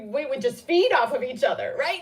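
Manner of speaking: ordinary talk, nothing marked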